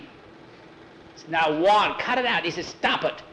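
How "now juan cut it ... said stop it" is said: high, sharp-pitched telling-off; exasperated plea to quit; urgent scolding. Acted. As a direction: cut him off, voice pitched up. Jackie scolds Juan to stop.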